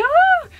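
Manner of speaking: high, loud delighted squeal; sudden fangirl shriek; excited squeal. Retold or imitated. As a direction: squeal like a starstruck fan. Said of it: "and I was like".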